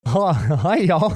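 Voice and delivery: lightly put-on Southern greeting; Southern accent. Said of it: "resort to a fucking Southern accent".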